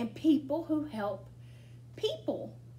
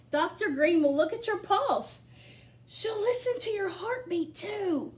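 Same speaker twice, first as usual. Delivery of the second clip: bright, lively picture-book reading; reading to children; 5 semitones higher, 4 dB louder than usual. Acted. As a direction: read it to a room of small kids. Reads picture book aloud.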